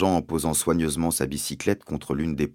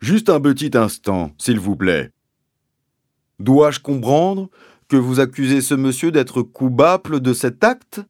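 higher, loud, pompous sergeant; police sergeant swapping P and B; 8 semitones higher, 8 dB louder than usual. A narrator's reading. Sergeant questioning the landowner's accusation, pompous, P and B swapped. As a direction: pronounce every P as B, with official ponderousness. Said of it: "remplacer les P par des B".

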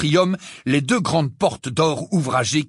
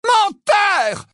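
high, loud shriek; screamed accusation; 5 dB louder than usual. A narrator's reading. Bellatrix screaming at Harry that he lies. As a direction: spit the word out in fury. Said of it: "vociféra-t-elle".

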